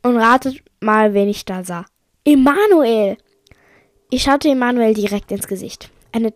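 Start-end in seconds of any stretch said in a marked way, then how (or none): 2.2-3.2 loud, high cry of the name; shocked exclamation. Acted. Shocked reveal of the culprit.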